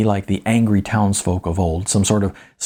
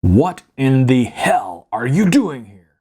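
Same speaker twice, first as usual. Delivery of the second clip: slow, loud and higher, leaning on you; a furious dad's booming voice; angry demand; 4 semitones higher, 4 dB louder than usual. Acted. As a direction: demand an answer like an angry father. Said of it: "Phil D demanded".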